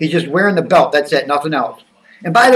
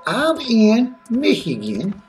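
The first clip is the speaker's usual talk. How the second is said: put-on character voice, pitched up and swinging; Skip. Skip chatting to viewers about wrestling, put-on excitable character voice. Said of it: "So, so it's Skip now".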